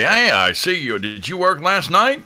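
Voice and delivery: high, booming-cheery radio host put-on; hammed-up on-air voice; Todd Hager. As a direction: play the DJ fishing for recognition. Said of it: "He would start talking like that".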